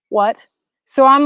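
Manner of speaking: usual speaking voice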